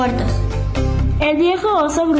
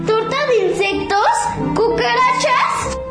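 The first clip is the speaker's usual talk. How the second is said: high, appalled squeal; horrified at eating cockroaches; shocked disgust; 7 semitones higher than usual. Acted. Animals disgusted by bug cake.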